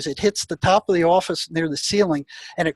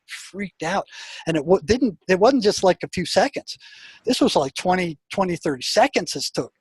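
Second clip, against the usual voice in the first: ordinary delivery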